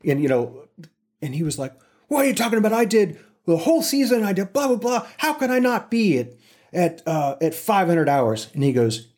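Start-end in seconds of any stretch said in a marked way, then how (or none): 2.1-8.5 higher, pushy complaint; indignant protest. Retold or imitated. Bob protesting to the production manager, indignant higher complaint. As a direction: protest the unfairness.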